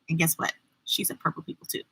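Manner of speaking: soft, whispered confidence; whispered secret. Real, not acted. Telling the children a secret, whispered. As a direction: lean in and whisper it.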